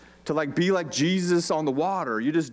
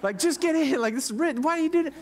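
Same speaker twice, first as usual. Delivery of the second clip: high-pitched mock-frantic pleading; hammed-up alarm, voice pitched way up; panicked guy in the boat; 11 semitones higher than usual. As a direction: plead like you're hauling a friend back by his coat. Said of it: "I would be the guy in the boat grabbing Peter's coat and go".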